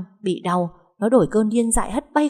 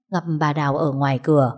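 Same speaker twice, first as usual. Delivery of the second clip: ordinary delivery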